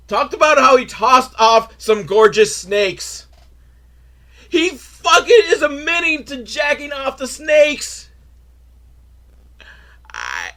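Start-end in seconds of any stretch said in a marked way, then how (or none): 4.5-8.1 high-pitched, disbelieving cry; incredulous outburst. Real, not acted. Reviewer appalled at what Steve Irwin admits, high incredulous outburst. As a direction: exclaim it high in comic outrage.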